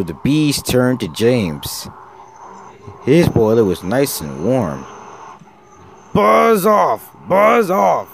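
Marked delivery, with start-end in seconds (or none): 6.1-8.1 loud, very high-pitched shout; angry yell. A narrator's reading. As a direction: yell to drive them away. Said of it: "yelled James".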